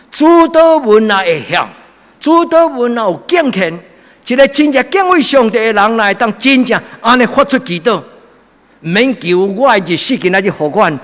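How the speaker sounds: high, loud impassioned sermon; fervent preaching. Acted. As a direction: preach it with full fire. Preaching at full force.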